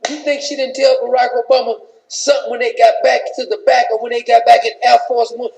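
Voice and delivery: ordinary delivery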